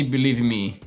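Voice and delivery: ordinary talk, nothing marked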